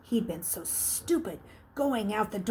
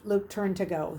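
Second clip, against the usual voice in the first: usual speaking voice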